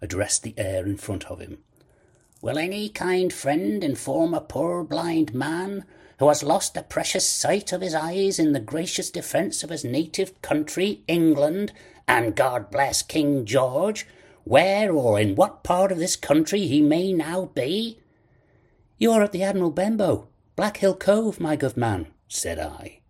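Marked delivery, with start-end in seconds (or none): 2.4-18.0 high, lilting sing-song plea; raised voice, swooping up and down like a beggar's chant; odd sing-song. A narrator's reading. Blind man addressing the empty air, odd sing-song. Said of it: "raising his voice in an odd sing-song". 19.0-22.1 put-on high-pitched, quick boy's voice; young boy. A narrator's reading. Boy telling the blind man where he is, young boy's voice.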